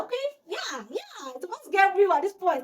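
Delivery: high-pitched gleeful yeah; retold happy excitement. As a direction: re-enact being delighted trouble is coming. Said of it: "I was happy".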